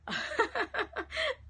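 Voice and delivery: high burst of laughter; real high-pitched laugh; amused laugh. Real, not acted. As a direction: laugh out loud, high and free. Amused by a viewer comment.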